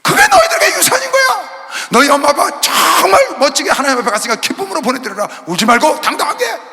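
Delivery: put-on high, loud, rousing cry to the children; soaring shouted encouragement; fervent exhortation. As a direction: lift them up, proclaim it. Pastor urging orphaned children.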